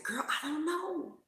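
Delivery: high, soft, timid little voice; imitating anxious friend. Friend answering the teller, imitated high and soft. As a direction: mimic her scared friend, small and unsure. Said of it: "She's like".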